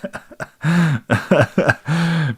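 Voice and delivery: high-pitched burst of laughter; amused laugh. Real, not acted. Reacts to [name] comparison.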